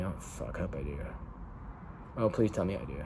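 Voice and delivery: very soft worried muttering; hushed dismay to self; quiet panic. Real, not acted. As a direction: mutter under breath in panic. Panicking over missing message.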